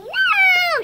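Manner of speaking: high, loud scream of no; shouting. Acted. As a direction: shriek it as the dragon grabs her. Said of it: "shouted the bunny".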